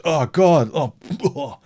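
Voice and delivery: disgusted recoil. Acted. Client pulls away after the escort farts, disgusted. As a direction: recoil with an appalled "oh".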